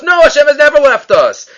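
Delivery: high-pitched, insistent rebuttal; emphatic denial. Acted. David answers his own rhetorical question, emphatic denial.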